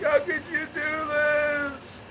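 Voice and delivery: high, slow, wailing mock grandma; hammed-up tearful old woman pleading; imitating crying grandmother. Grandmother upset over grandson's baptism, mock wailing. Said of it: "she's crying and crying".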